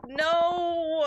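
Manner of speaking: loud, high cry of dismay; dismayed outcry. Real, not acted. Streamer realising a mistake in settings, dismayed outcry. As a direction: wail it out, drawing out each no.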